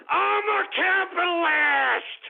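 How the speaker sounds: high, drawn-out indignant cry; outraged declaration. Real, not acted. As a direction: stretch it out high, proclaiming your title. Outraged at being compared to Nazi.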